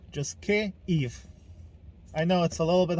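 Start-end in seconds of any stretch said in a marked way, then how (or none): 0.4-1.1 Kyiv split in two, 'kay... eev'; higher, syllable-by-syllable Kyiv; Ukrainian pronunciation. Shown as a demonstration. Vlogger teaching viewers the name, Kyiv broken into two syllables. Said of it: "please say Kyiv".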